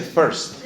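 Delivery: "first" sounding like it has an E; corrected pronunciation. Shown as a demonstration. Teacher insisting to a doubting learner, corrected pronunciation. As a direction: say "first" as "ferst". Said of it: "me suena como una E".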